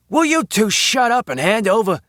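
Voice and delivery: high-pitched, fed-up robber's outburst; exasperated demand. A narrator's reading. Nervous robber to the chattering couple, exasperated. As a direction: cut off their chatter with an order.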